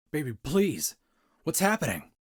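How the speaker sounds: high, loud frantic begging; panicked pleading. Acted. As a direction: beg him in rising panic.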